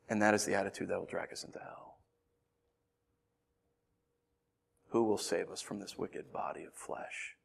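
low, very soft, grave sermon voice; hushed preaching. Acted. Preacher lamenting ego and hubris, hushed preaching. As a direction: drop to a near whisper.

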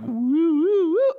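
high, swooping "woo" sound effect; imitated tongue-curling noise. Showing how a giraffe's tongue comes out, high wobbly whoop. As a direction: trace a curling tongue with your voice.

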